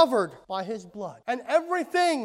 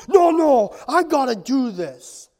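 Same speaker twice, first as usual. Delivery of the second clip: loud, stubborn refusal; insistent child; 9 dB louder than usual. Acted. Son refusing his father's help, loud insistent protest. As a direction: burst out in protest as the small son.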